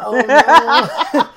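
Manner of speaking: laughing mock oh no. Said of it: "He's like".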